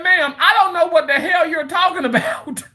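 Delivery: laughing retort. Retold or imitated. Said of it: "And I said".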